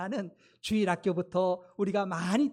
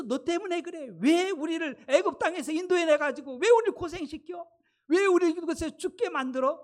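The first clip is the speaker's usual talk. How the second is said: high, louder mock complaint of a crowd; acted angry accusation; 7 semitones higher, 4 dB louder than usual. Israelites attack Moses over leaving Egypt, acted angry accusation. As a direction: hurl the blame as the aggrieved people. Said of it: "모세를 공격하고".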